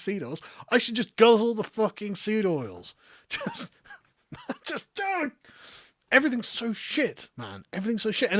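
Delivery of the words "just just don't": squeaky high laugh through the words; can't stop laughing as he speaks; real giggles breaking up the line. Real, not acted.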